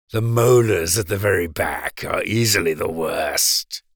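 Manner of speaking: low, smug crocodile voice; crocodile declaring. A narrator's reading. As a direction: state it like a lordly crocodile. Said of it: "Crocodile declared".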